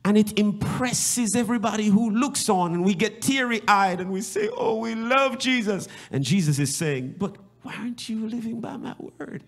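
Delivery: very high, loud, sung-out preaching; impassioned preaching. Acted. Pastor calling out to the congregation in a high, singing preach. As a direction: lift it into a singing cry of worship.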